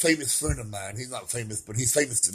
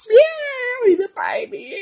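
much higher cooing voice; 3 dB louder, slower than usual. Real, not acted. Cooing to his cat in high baby talk.

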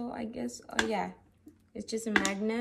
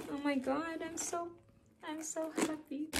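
happy; 6 semitones higher, 5 dB quieter than usual. Real, not acted.

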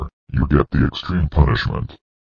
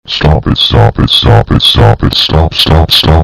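much louder, frantic demands; loud repeated stop; 7 dB louder than usual. Acted. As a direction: hammer out each stop.

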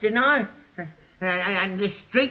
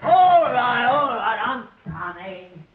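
calling out; 5 semitones higher, 4 dB louder, slower than usual. Real, not acted. Calling out to someone at door.

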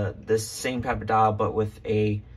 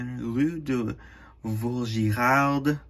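French accent; 3 semitones higher, much slower than usual. Shown as a demonstration. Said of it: "Sorry for my French accent there".

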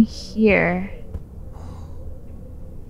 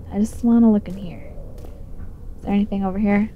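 ordinary delivery

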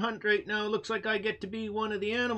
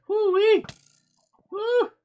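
excited whoop; 10 semitones higher, 7 dB louder than usual. Real, not acted. Reacting to the stink.

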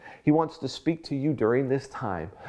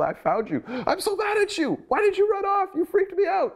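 hammed-up scold of a runaway son, pitched way up; relieved and angry parent; 3 dB louder than usual. Parent scolding lost son, relieved but angry, hammed up high. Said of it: "so relieved and you're so angry".

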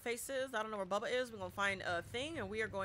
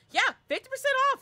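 excited; 10 dB louder, faster than usual. Real, not acted. Excited sale announcement.

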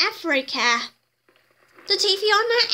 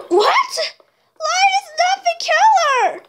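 high, loud, surprised and laughing; surprised squeal; 8 semitones higher, 4 dB louder than usual. Pretend. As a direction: squeal it out in playful disbelief. Child surprised by toy lion.

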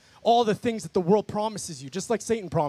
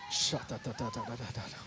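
speaking in tongues; 9 semitones lower, 12 dB quieter than usual. Real, not acted.

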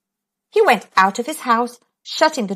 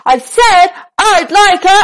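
very loud, high shout; top-of-voice shout; 8 semitones higher, 7 dB louder than usual. Acted. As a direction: bellow as hard as possible. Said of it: "shouted at the very top of his voice".

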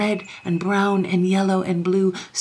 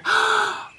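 high, sharp intake of wonder; amazed gasp. A narrator's reading. Young king sees the library full of books, amazed gasp. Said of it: "when Lionel cried out".

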